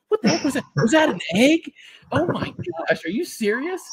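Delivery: very high-pitched incredulous outburst; mock horror. Friend retelling the cracked egg moment, high mock horror. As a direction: squeal it like an appalled onlooker, hammed up.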